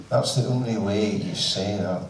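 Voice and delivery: ordinary talk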